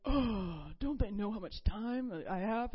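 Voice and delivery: huffy, acted-out complaint; offended exasperation. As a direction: sigh hard, then grumble in mock offense. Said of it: "before, I'd be like".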